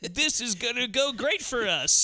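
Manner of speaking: high jeering voice, slowing at the end; mocking bully voice. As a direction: taunt like a cocky teenage bully. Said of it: "show up and are like".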